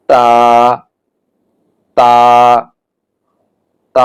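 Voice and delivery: "তা" with tongue tip at the upper tooth roots; held "তা", tongue on the upper tooth roots; Arabic letter demonstration. Shown as a demonstration. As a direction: say each "তা" separately, tip behind upper teeth. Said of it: "উপরের দাঁতের গোড়ার সঙ্গে লাগাতে হবে".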